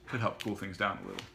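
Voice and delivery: ordinary delivery, nothing marked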